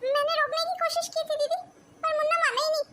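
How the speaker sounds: high, pleading self-defence; anxious excuse. Acted. Explaining to the boy's upset mother, anxious and defensive.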